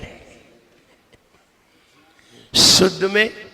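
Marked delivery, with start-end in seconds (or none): 2.6-3.0 शुद्ध starting with a forceful "sh"; whistling sibilant. Shown as a demonstration. Teacher points out the whistle, hissed "sh" in शुद्ध. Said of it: "सीटी आती है".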